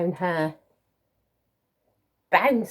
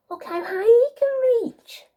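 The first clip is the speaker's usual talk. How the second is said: delighted; 5 dB louder than usual. Real, not acted. Admiring the reaching child.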